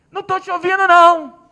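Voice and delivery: high, loud rude shout; angry shout. Retold or imitated. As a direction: yell him down, annoyed. Rude woman yells at father.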